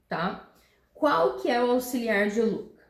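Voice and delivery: normal talking voice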